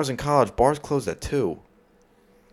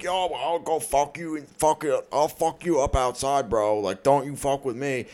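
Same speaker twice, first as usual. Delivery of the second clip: mock Philly frat-guy accent, higher and slower; 4 semitones higher than usual. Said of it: "your Philly accent is getting way better".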